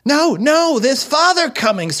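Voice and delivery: put-on high, urgent child's warning; excited children's cry. A narrator's reading. Young Cratchits warning that Father is coming, excited high cry. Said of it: "cried the two young Cratchits".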